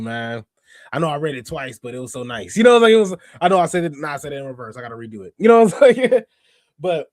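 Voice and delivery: ordinary delivery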